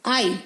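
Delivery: आई said as 'eye', as in pine; long आई, the vowel of 'pine'; long I sound. Shown as a demonstration. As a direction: give आई the letter name of I. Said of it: "आई इसका long sound है".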